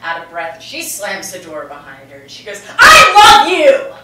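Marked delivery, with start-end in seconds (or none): 2.8-3.9 loud, high, slow yell of love; breathless mother yelling after her daughter; shouted declaration. Retold or imitated. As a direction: bellow it out the door.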